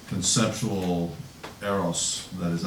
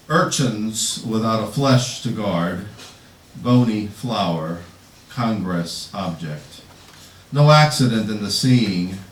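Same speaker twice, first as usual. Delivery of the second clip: slow, measured poem recitation; poetry reading; 5 dB louder, slower than usual. Acted. Poet reading an obituary to patio furniture, measured recitation. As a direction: read it slowly, leaning into the images. Reads own poem aloud.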